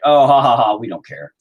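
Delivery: loud fake ha-ha, then brush it off; mock dismissive laugh. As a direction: imitate cops laughing off a complaint. Imitates cops laughing it off.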